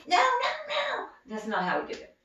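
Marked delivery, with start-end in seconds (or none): 0.1-1.1 high, loud whiny screaming no; mock tantrum no. Shown as a demonstration. Child showing the wrong way to refuse, whiny screamed no. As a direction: throw a fit like a bratty kid. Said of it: "scream and yell and pitch a fit".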